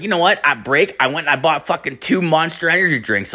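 high, eager caffeinated retelling; mock buzzing friend voice; imitating wired friend. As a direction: play a buddy proud of a bad idea. Wired friend recounts buying drinks.

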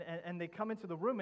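normal talking voice

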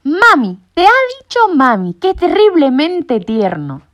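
high, loud, melting over the chick; gushing know-it-all. A narrator's reading. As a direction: gush at how sweet it is. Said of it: "alcanzó a exclamar Sabelotodo". (sabelotodo enternecido exclamando)

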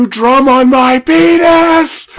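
loud, high, slow shouting Australian voice; Australian accent. Retold or imitated. Retold Australian sketch guy, loud high shout. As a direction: bellow it like an outraged Aussie. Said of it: "Isn't this Australian?".

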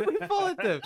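high, loud, laughing echo; helpless laughter riding over the words. Real, not acted. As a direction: repeat it while cracking up. Cracking up, echoing the friend.

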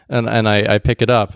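ordinary talk

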